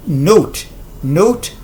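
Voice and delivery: note with a gliding oh-oo vowel; long o sound. Shown as a demonstration. Teacher drilling the word against not, long gliding o. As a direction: say "note" slowly as noh-oot. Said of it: "on a le son O".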